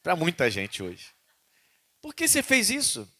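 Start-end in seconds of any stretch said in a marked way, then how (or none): 2.0-3.1 much higher, searching question; God asking why. Retold or imitated. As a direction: ask with pained insistence. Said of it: "Deus tá falando isso".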